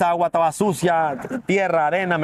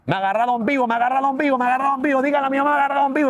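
retold yelling; 7 semitones higher, faster than usual. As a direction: shout to the crowd to save you, a reenacted version. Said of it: "empecé a gritar".

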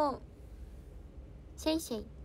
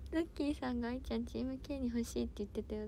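usual speaking voice

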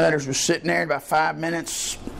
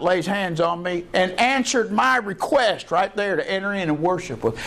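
usual speaking voice

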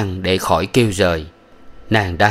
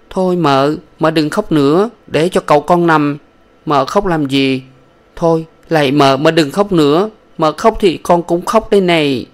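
higher, louder begging tone; pleading young woman; 8 semitones higher, 4 dB louder than usual. Acted. Thảo begging her mistress to stop crying, higher pleading voice.

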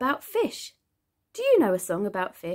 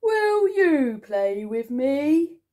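drawn-out, louder pleading invitation; hopeful shark; 3 semitones higher, 5 dB louder, slower than usual. A narrator's reading. Smiley Shark asks Angel Fish to play, hopeful and drawn out. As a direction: voice a shark slowly asking for a playmate.

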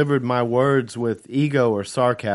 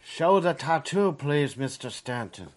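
soft, lightly voiced thick European accent; 4 semitones higher, 6 dB quieter than usual. A narrator's reading. An old man asking Stanton to show it, thick European accent. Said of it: "a man said in a thick European accent".

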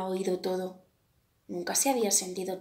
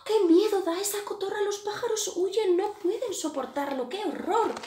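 frightened birds; 10 semitones higher, 4 dB louder than usual. A narrator's reading. Birds flee again.

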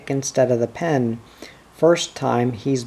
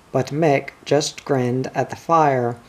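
plain reading voice

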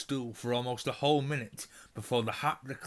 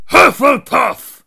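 huge, slow booming shout, much higher; hat shouts; 14 semitones higher, 20 dB louder, slower than usual. A narrator's reading. The Sorting Hat announces Hannah's house with a booming shout. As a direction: bellow the house name to the whole hall. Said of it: "shouted the hat again".

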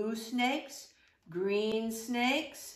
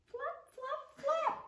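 high-pitched flapping; 5 dB quieter than usual. Acted. Imitating bat wings.

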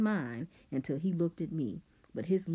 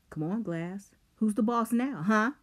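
loud mocking taunt; 6 semitones higher, 5 dB louder than usual. A narrator's reading. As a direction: taunt him. Rob taunting Simon.

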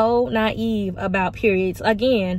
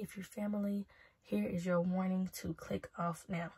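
quiet disclaimer; 2 semitones lower, 16 dB quieter than usual. Real, not acted. Warning family to click off, said softly and hushed. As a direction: keep it soft and hushed, almost confiding.

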